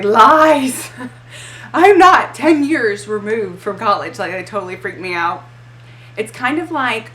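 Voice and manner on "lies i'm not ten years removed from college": mock outrage. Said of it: "It totally had me freaking out".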